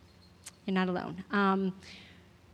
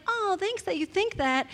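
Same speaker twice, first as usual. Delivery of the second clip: higher-pitched, sweetly flattered reaction; pleased thanks; 9 semitones higher than usual. Retold or imitated. Speaker replaying her reaction to the pastor's compliment, pleased. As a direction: say it high, as if touched by a compliment. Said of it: "two opposite reactions to that statement".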